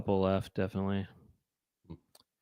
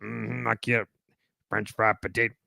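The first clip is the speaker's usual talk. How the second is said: low grunting Sling Blade; mm-hmm it low like Karl; 5 semitones lower, 4 dB quieter than usual. Retold or imitated. Adding Sling Blade to Romano, lower grunt. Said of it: "add a little bit of".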